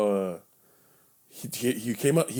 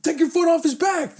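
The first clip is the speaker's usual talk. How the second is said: high-pitched outraged protest; retold indignant demand; 4 dB louder than usual. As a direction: act out yelling at a cop to back off.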